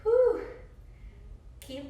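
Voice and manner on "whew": relieved exhale. Real, not acted. Exhale during exercise.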